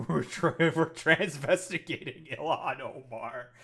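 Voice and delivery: loud, slow words breaking into laughter; laughing. Real, not acted. Commentator amused by an absurd new claim, laughing. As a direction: crack up while getting the words out. Cracks up at the post.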